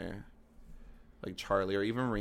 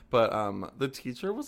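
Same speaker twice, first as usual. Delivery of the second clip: ordinary talk, nothing marked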